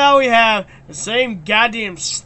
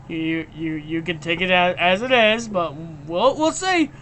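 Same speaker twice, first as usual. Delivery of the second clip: normal talking voice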